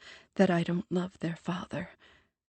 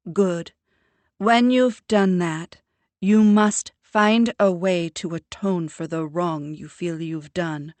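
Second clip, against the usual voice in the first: lightly voiced smug mother, louder; a mother lecturing with prim self-assurance; proud mother; 11 dB louder than usual. A narrator's reading. Mother lecturing her grown daughter about true crime, smug and louder.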